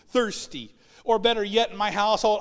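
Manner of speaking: ordinary talk, nothing marked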